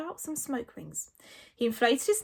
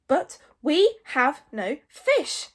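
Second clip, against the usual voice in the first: slow, loud, deliberate statement; raised, measured, dominating tone; firm but pleasant; 5 semitones higher, 7 dB louder, much slower than usual. A narrator's reading. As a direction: space out each word with polite authority. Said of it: "make his voice sound firm, but pleasant".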